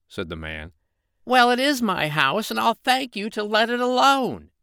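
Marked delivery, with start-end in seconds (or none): 1.2-4.5 high, loud fairy voice, huffy; loud high fairy telling him off; cross fairy. Acted. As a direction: snap back as the little old fairy. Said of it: "cried the fairy".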